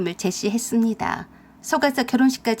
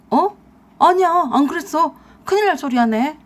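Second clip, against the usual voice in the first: higher, louder flustered denial; panicked denial; 5 semitones higher, 5 dB louder than usual. Acted. Husband caught out, panicked and louder denying to wife. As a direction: deny it in a rattled rush.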